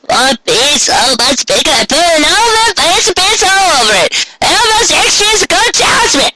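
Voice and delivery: put-on very high old voice rising in indignation; a grandma bristling about the price; suspicious old granny. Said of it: "her voice almost getting angry".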